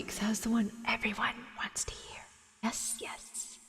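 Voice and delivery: soft whispered aside; whispering. Real, not acted. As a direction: whisper it to yourself.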